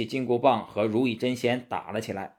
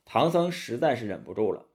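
usual speaking voice

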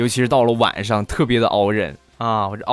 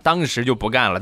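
usual speaking voice

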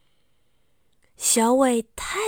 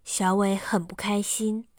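ordinary delivery, nothing marked

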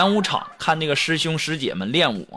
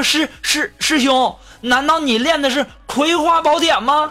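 high-pitched, stuttering excited question; excited stammer; 10 semitones higher than usual. Acted. Junior disciple asking a senior brother about his secret martial art, excited and stammering. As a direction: blurt it out, agitated, tripping on the first word. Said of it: "我就激动地问".

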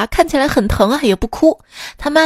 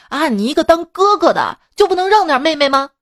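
提高嗓门半认真地吼; 像爸爸训儿子那样喝斥; 模仿爸爸吼; 5 semitones higher than usual. Retold or imitated. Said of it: "冲着哥哥就吼道". (模仿爸爸冲哥哥吼)

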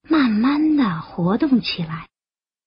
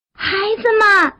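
high, carrying motherly call; loud calling; 10 semitones higher than usual. A narrator's reading. Frog calling tadpoles from a lotus leaf, high calling. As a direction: call out to the children. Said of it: "大声地喊".

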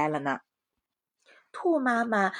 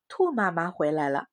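plain reading voice

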